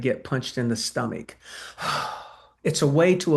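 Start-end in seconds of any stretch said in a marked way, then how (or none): 1.5-2.2 a soft unvoiced sigh out; demo exhale. Shown as a demonstration. Showing the exhale a seller gives before a hit. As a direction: breathe out like absorbing a blow. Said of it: "When somebody gives you an exhale".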